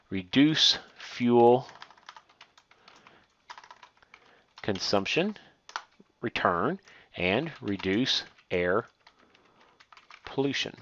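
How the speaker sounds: slow and soft, spacing the words out; slow dictation while typing. Shown as a demonstration. Teacher to students, typing the bullet items, slow quiet dictation. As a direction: spell it out slowly as if typing each word. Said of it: "so we typed in those two bulleted items".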